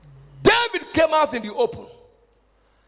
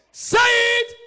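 high, punched command; urgent exhortation; 10 semitones higher, slower than usual. Real, not acted. Preacher urging would-be victors to speak up, high exhortation. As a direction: pitch it high to rouse them.

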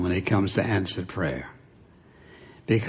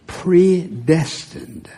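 "predestined" drawn out slowly and higher; emphatic key word; 5 semitones higher, much slower than usual. Real, not acted. Preacher showing a key word to the congregation, "predestined" slow and emphatic. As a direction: stretch out "predestined" syllable by syllable, pitched up.

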